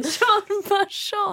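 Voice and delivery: high, very slow, snooty Jean Valjean; mock upper-class savoring; posh drawl. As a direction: stretch the name out, all airs. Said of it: "savoring the phrase".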